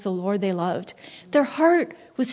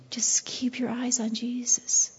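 whisper; 6 dB quieter than usual. Acted. Preacher drops to intimate hush.

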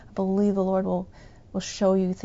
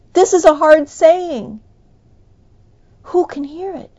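loud, higher, complaining cry; disciples' protest; 7 semitones higher, 11 dB louder than usual. Retold or imitated. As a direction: push back like a doubting follower.

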